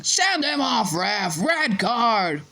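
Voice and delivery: high-pitched yell, no real extra volume. A narrator's reading. Dean demanding a red card from the stands, yelling. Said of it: "Dean Thomas was yelling".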